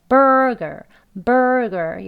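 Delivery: "burger" slowly with strong BUR; stress on first syllable. Shown as a demonstration. Teacher drilling the part first, "burger" front-stressed. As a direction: punch BUR in "burger", relax "ger". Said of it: "여기 먼저 두 개 연습".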